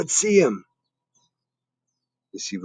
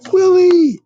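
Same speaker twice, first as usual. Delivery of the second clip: very high, loud shout; delighted cry; 11 dB louder than usual. Acted. Narrator greeting the dog's arrival, delighted. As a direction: cheer for the dog.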